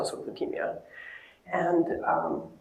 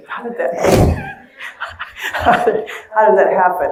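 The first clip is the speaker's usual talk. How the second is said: laughing through a quoted question; imitating puzzled kids through laughter; 6 semitones higher, 8 dB louder than usual. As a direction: break up laughing, then get the question out louder. Laughing while quoting the amazed kids.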